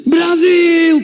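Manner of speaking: high, loud stadium shout of Brasil; crowd shout. Retold or imitated. Brazilian fans greeting every train with a loud roar. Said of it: "con un grito".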